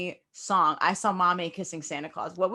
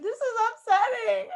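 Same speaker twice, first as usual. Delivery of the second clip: higher, slow, laughing through it; laughing mock despair. Recalling childhood complaint to mom, laughing. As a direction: whine it while giggling. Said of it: "I'd be like".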